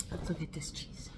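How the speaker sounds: whisper. Acted. Repeats line in a whisper.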